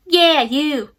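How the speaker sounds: put-on single someone out in a cheeky English accent; English accent pointing. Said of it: "talked with an English accent".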